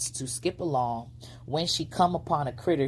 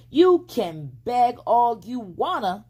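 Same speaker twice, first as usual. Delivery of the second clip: high, louder, slow taunt; taunting girl; 8 semitones higher, 7 dB louder, slower than usual. A narrator's reading. Flossie teasing the fox as she skips off, loud. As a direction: toss it back at him.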